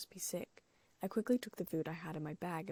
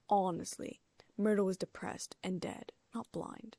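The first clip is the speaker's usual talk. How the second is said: ordinary delivery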